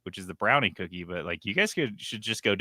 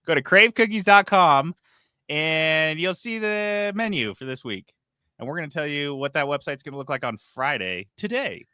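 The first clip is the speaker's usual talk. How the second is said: higher, louder upbeat ad voice; sponsor ad read; 7 semitones higher, 4 dB louder than usual. Acted. Host to listeners, cheery sponsor pitch. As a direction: sell the cookies like a cheery radio spot.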